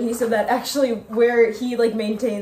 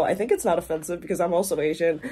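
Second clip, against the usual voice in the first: normal talking voice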